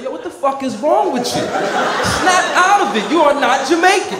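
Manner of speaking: high, loud scolding; acted fed-up yell, stretching the words; exasperated shout. Friend telling him he's not Jamaican, high exasperated shouting.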